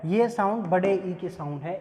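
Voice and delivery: ordinary delivery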